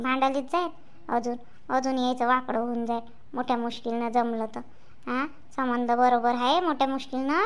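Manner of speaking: mother muttering to the father away from her son; hushed aside. Real, not acted. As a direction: keep it down so the son won't hear. Hushed aside so son can't hear.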